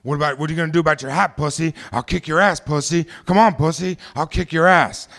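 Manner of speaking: loud, high, rapid-fire taunting yell; screaming bully. Retold or imitated. Bully goading a twelve-year-old into a fight, screaming taunts. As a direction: imitate a kid screaming threats in someone's face. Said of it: "he's screaming in my face".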